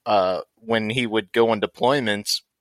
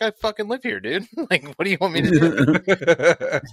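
higher, laughing indignant protest; indignant retort; 7 semitones higher than usual. Retold or imitated. To the police at the door: higher, laughing indignation. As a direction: push back at the cops, half laughing. Arguing with officers.

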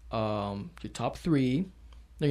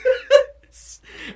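high laughing whimper; high teary giggle; laugh near tears; 10 dB louder than usual. Real, not acted. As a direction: laugh half crying. Laughing about crying in theater.

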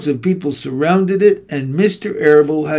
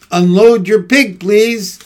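raised voice of a fair PA announcer; loudspeaker announcer; 6 semitones higher, 5 dB louder than usual. A narrator's reading. Loudspeaker orders the Zuckermans to unload, announcer voice. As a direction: call it out over the loudspeaker. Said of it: "said the loudspeaker".

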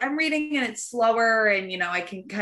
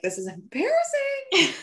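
embarrassed laugh; 13 semitones higher than usual. Retold or imitated. Embarrassed crying on an airplane.

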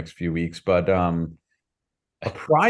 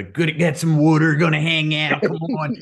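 higher-pitched raspy Philly impression; raspy Philly accent; 4 semitones higher than usual. Co-host mimicking his friend's next-level accent, raspy Philly accent. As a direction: imitate a rasping Philly local. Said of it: "accentuated by the raspiness".

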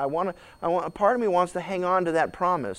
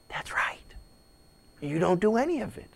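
whispered God; 8 dB quieter than usual. Retold or imitated. God answering softly.

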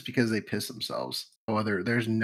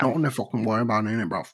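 quick, louder mock London accent; British accent; 4 dB louder, faster than usual. Shown as a demonstration. Showing co-host a British accent, quick thick London. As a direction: rattle it off like a London lad. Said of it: "I guess you could do British".

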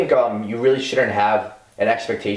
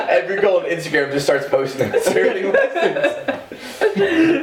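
high, slow, laughing through words; cracking up mid-sentence; 12 semitones higher, slower than usual. Real, not acted. Laughing while mocking girls.

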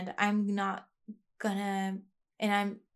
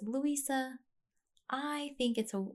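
higher, lightly voiced therapist; 5 semitones higher, 3 dB quieter than usual. Quoting her therapist to listeners, lightly higher voice. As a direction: begin the therapist quote a touch higher. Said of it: "she has such a high voice in my head".

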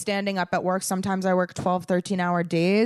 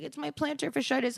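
mocking whiny mom; 7 semitones higher, 6 dB quieter than usual. Mom complaining, mocked by daughter.

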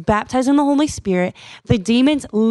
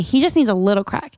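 usual speaking voice

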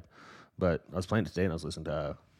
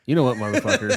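laughing mock threat; 6 semitones higher, 10 dB louder than usual.

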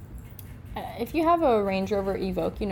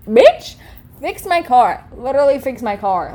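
loud, furious demand; much louder, higher angry outburst; angry retort; 4 semitones higher, 9 dB louder than usual. Real, not acted. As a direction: yell it at him.

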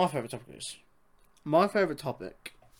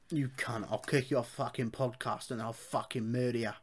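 soft mock Cockney threat; London accent; 2 semitones lower, 7 dB quieter than usual. Shown as a demonstration. Host threatening back in the bit, quiet London accent. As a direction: keep the London menace quiet. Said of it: "Give us your best London accent".

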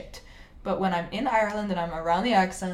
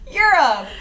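high, loud cheer with a laugh; excited exclamation; 9 semitones higher, 12 dB louder than usual. Real, not acted. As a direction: whoop it out like a holiday announcement. Repeats exclaimed new topic.

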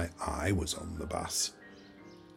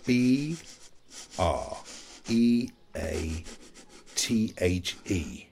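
spelling out; slower than usual. Acted. Spelling a word out from a list.

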